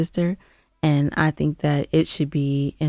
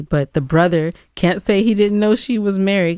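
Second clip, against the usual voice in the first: normal talking voice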